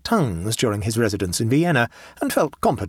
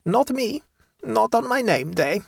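put-on high German-accented countrywoman, self-assured; composed peasant woman, pitched high; 12 semitones higher than usual. A narrator's reading. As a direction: explain with complacent certainty, German accent. Woman answers, sure of protection.

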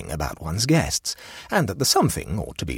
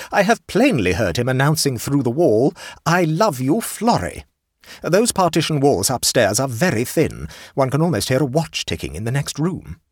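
put-on higher and louder indignant old lady; aunt complaining to the hostess, indignant; stern aunt; 8 semitones higher, 5 dB louder than usual. A narrator's reading. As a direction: lay down the law like an affronted aunt.

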